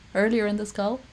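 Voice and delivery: ordinary delivery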